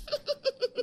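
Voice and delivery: high mocking giggle; sister-in-law laughs at the lisping bride. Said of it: "जोर जोर से हंसने लगती है".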